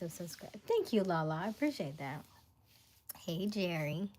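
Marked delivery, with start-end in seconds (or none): none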